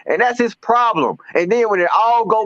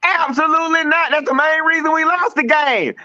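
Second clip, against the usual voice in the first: ordinary talk, nothing marked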